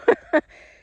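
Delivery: a loud, high burst of laughter; emotional laugh. Real, not acted. Owner relieved the calf is safe, laughing.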